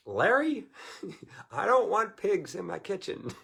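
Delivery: high, loud exasperated wife; mock mom scolding high and loud; mom's protest. Mom telling dad Larry no pigs, high indignant. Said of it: "my mom's like".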